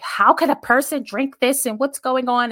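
high, loud, baffled disbelief; incredulous imitation of puzzled coworkers. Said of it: "they're more so baffled".

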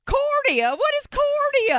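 very high, baffled mock "Korea? What is Korea?"; dumb confused voice. Mocking her own clueless question about Korea, high and baffled. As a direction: pitch it way up, like a clueless dog tilting its head. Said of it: "Could I have sounded more like a".

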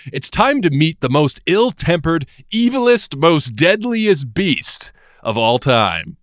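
high build-up slowing on the last phrase; mock-dramatic announcer. Host introducing the deadliest beast, mock-dramatic announcer. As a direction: hype it up as a grand reveal.

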